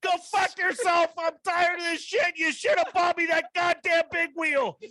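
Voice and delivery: very high, screamed tirade; retold losing it. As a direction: keep yelling like an enraged son on the phone. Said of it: "I'm fucking losing it".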